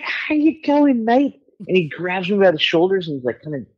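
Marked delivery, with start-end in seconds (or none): 0.0-1.4 very high, slow British matey hello; drawn-out, high-pitched chummy greeting; friendly greeting. Retold or imitated. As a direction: imitate a rock star greeting a pal. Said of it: "friendly way".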